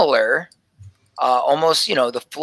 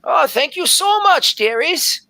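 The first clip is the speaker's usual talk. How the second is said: put-on high, sweet thanks from a fussy old lady; a much higher, doting old elf voice; gushing old elf scribe; 13 semitones higher, 3 dB louder than usual. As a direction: coo your thanks like a grandmother. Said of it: "she says".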